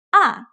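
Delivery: short "Ah" falling sharply from high; fourth tone. Shown as a demonstration. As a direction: drop "Ah" quickly from a high start. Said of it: "starts very high but quickly goes down".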